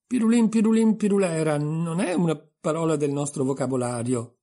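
replica grave e marcata, attacco alto e forte. A narrator's reading. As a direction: ribatti con serietà, calcando le prime parole. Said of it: "replicò Alice gravemente". (obiezione seria, attacco deciso)

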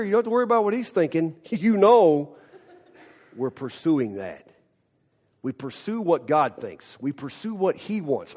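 ordinary delivery

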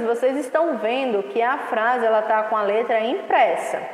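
ordinary talk, nothing marked

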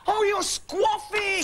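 high, slow shout of realisation; loud blurt. Acted. Friend finally understanding her whisper, shouting it aloud. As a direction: shout it out as the penny drops.

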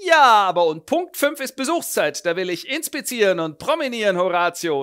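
high, loud theatrical declaiming; a hammed-up stage tragedian; pompous actor. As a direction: declaim like a vain ham actor. Pompous actor voiced in retelling.

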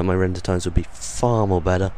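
usual speaking voice

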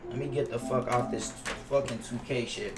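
ordinary delivery

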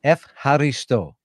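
slowly sounding out F, haristo; careful learner's repetition. Shown as a demonstration. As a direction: try F, haristo slowly after the teacher. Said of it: "How can I say this?".

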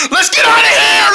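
scared, high cry to flee; panicked yell. Acted. Deer fleeing the dinosaur, panicked high yell. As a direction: shout it in terror.

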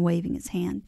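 plain reading voice

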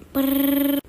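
mouthed phone ring, a buzzing trill. Acted. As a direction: imitate a phone ringing with a lip buzz. Phone ringing imitated.

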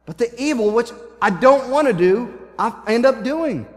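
frustrated crying out, preacher's version; Paul. Retold or imitated. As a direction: plead it out, leaning on each contrast. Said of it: "I can see Paul's frustration as he's crying out".

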